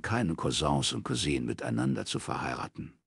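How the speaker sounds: ordinary delivery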